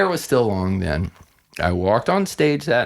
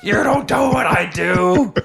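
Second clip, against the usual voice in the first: angry rant; 9 semitones higher than usual. Retold or imitated. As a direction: tell off the journalist, slowing at the end. Retold angry reply to journalist.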